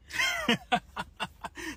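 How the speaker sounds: high, short laugh through the pain. Real, not acted. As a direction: laugh to keep from breaking. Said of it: "make yourself laugh in the pain".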